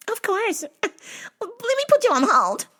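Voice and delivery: very high falsetto clerk; squeaky, fake-polite phone disguise; disguised phone voice. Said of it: "I'm gonna change my voice every time you call".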